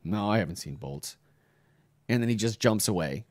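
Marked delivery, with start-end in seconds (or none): 0.0-1.1 hushed denial. Retold or imitated. Hiding son quietly lies to his searching father. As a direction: lower and softer, keeping hidden.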